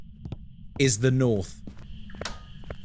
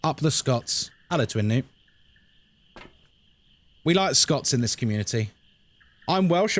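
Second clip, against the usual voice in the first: ordinary talk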